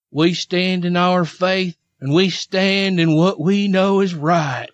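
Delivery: put-on slow, pious Southern accent; mock Southern accent. Host quoting Todd Chrisley on faith, slow mock Southern accent. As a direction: stretch it like a Southern believer.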